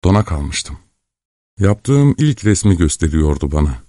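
plain reading voice

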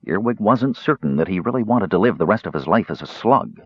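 plain reading voice

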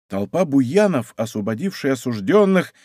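ordinary delivery, nothing marked

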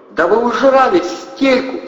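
high, loud, slow shriek. A narrator's reading. Anna shrieking at the hungover men. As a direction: shriek it at them. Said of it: "взвизгнула Анна".

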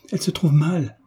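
mildly alarmed duchess calling out; alarmed exclamation. A narrator's reading. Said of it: "s'écria la duchesse".

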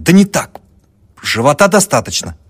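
loud, high, embarrassed protest; flustered. A narrator's reading. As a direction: stop her in a panic. (смущённый студент громко останавливает Марью)

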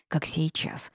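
plain reading voice

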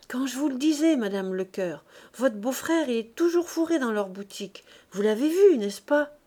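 holding forth. A narrator's reading. Old maid gossiping to her cronies, holding forth. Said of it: "La vieille fille pérorait".

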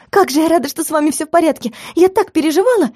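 high, gushing relief; breathless-with-relief welcome in a high voice; relieved joy. Acted. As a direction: greet them overjoyed, pitch climbing high. Greets with hugs and kisses.

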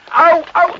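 high loud ouch cries; yelps of pain. Acted. Carlo yelps when struck in the scuffle. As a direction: yelp as you are hit.

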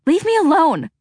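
high, loud cry of defiance; shrill teenage outcry; cried out. Acted. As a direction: fling it at her mother, pulling away. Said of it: "Freya cried".

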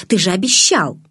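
indignant protest with a sharp peak. A narrator's reading. Bella reminds Edward of his promise, indignant. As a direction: push it out in indignation. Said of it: "Я едва сдерживала негодование".

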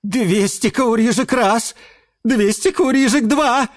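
rising, high auctioneer call; auctioneer closing. Acted. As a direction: build the count to sold. Auctioneer closes sale.

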